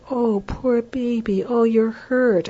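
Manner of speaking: high, slow and tender; soothing baby talk. Shown as a demonstration. Parent soothing the crying baby, slow tender coo. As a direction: coo over the hurt baby, slowly.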